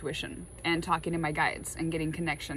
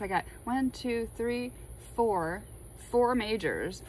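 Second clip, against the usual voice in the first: ordinary delivery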